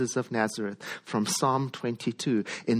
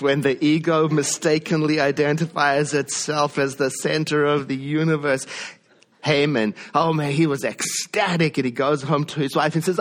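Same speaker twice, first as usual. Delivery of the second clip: usual speaking voice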